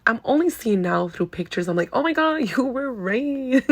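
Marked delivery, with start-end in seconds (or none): 1.9-3.7 high, laughing "oh my God"; laughing disbelief, pitched up; laughing realization. Retold or imitated. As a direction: burst out laughing at the truth. Realizing she was told right.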